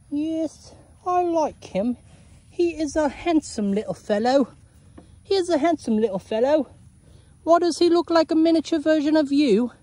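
high, playful cow character voice; talking cow. Pretend. Cow admiring the farmer's new baby, high character voice.

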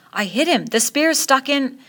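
excited, rising high on the second phrase; thrilled boast. Acted. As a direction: crow about the hit. Boy thrilled at hitting boar.

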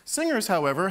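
ordinary talk, nothing marked

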